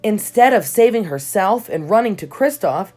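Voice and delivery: ordinary delivery, nothing marked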